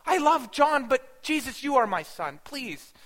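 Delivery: anguished high, loud cry opening into pleading; screamed-out plea. Retold or imitated. Mother at the cross begging her son, crying out. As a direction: beg desperately, bursting out on the first word. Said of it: "wanted to scream out".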